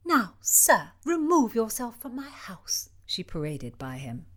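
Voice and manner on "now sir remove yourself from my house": high, haughty order; haughty dismissal. Acted. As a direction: dismiss him coldly. Princess dismisses Marquis.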